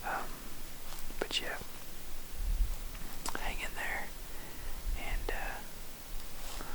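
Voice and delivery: hushed whisper from the tree stand; whispered update to viewers. Real, not acted. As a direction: keep it under your breath so the deer won't hear.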